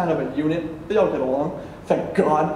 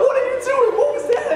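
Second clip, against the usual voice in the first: very high-pitched, squawking alarm; panicked yell; faster than usual. Retold or imitated. Kid to friend who hung up, acted panicked yell. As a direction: shriek it in disbelief.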